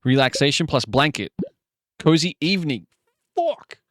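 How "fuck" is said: a very high, short "Fuck"; frustrated curse. Real, not acted. Streamer curses a bad combination in a high yelp.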